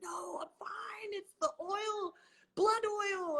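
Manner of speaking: hoarse slow whisper of a sick friend. Retold or imitated. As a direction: whisper it weakly as if ill. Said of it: "she's like".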